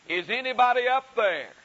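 high, loud call upward for help; desperate cry out. Acted. Man dangling off a cliff calls up for help, loud and desperate. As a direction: shout up the cliff for rescue. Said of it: "And so he cried out".

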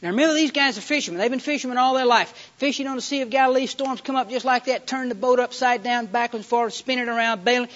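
high, driving, urgent storm narration; full-force preaching. Real, not acted. Preacher telling of fishermen fighting the storm, full force. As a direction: pile up the phrases loudly as the danger mounts.

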